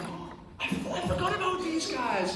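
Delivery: very high, laughing surprise; acted delighted rediscovery. Acting rediscovery of old trousers.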